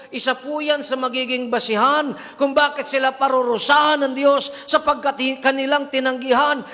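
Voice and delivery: high, loud, urgent preaching with a sharp No!; fiery preaching. Acted. Preacher warning the congregation against faithless preachers, loud and urgent. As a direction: warn them hard, then press on.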